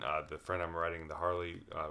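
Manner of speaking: normal talking voice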